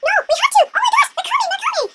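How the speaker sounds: high-pitched mock panic; frantic, girlish urgency; panicked princess. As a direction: squeal in hammed-up alarm.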